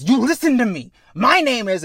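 high, loud acted command; impassioned yell; forceful kid's outburst. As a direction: shout to snap a friend out of panic. Kid shakes panicking companion, demands attention.

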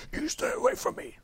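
hoarse high whispered demon snarl. Retold or imitated. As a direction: hiss it like a possessed kid. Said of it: "I did this demon voice too".

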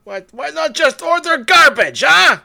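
high, loud mock Russian tirade; Russian accent. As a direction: shout indignantly in a hammed-up Russian accent. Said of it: "trying to do a Russian accent".

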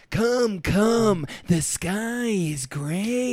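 slow, drawn-out Jack Nicholson voice; Jack Nicholson impression. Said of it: "you're doing Jack Nicholson".

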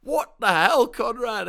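loud, high outraged yell; mock screaming. Host voices listeners outraged at co-host, mock scream. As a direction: shout it like an exasperated crowd. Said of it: "all the listeners screaming".

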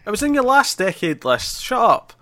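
jokingly cutting off the co-host; mock annoyed. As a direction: tease your friend, fake irritation. Teasing a co-host.

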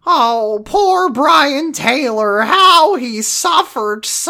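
high, slow melodramatic sigh; hammed-up grieving diva; mock theatrical lament. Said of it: "and she goes".

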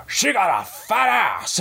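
high, slow shouted Pacino rant; Al Pacino impression. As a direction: yell it like Pacino in Heat. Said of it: "just going Al Pacino".

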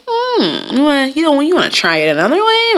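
very high then high coaxing question; fake-sweet suggestion. Suggesting another method to a coworker, sugary high. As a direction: sweetly nudge them. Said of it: "I'd be like".

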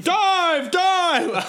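high squealing dive call; airplane spoon game. Pretend. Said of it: "Do I have to eat it".

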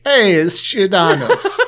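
slow, high sing-song announcement; singing out the pizza place name; silly sing-song. Retold or imitated. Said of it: "I have to say Giordano's like that".

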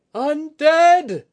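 high, loud alarm shout; crying out. Acted. Lookout spotting undead at the river, crying out. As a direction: shout the warning to everyone. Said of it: "Oguri cried out".

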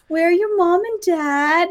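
put-on high, slow talking-to-a-child voice; kindly customs lady. Customs lady to a supposed child, cooing concern. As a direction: imitate an adult questioning a lost little one. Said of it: "and then she's like".

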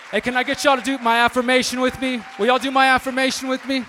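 loud call to the crowd. Real, not acted. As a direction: call out to the whole room. Calling out to crowd.

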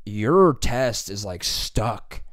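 very slow, drawn-out teacher complaint; mock teacher. Teacher finding the glued Scantron, speaking very slowly. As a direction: drag the words out like a stunned teacher. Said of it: "she was like".

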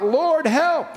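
high-pitched plea to the Lord; crying out for help. Acted. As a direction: cry out desperately, voice lifted high. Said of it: "if you'll cry out".

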